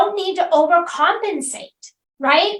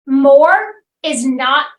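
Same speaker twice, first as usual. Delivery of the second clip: slow emphasis; slower than usual. Real, not acted. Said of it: "slow emphatic conclusion".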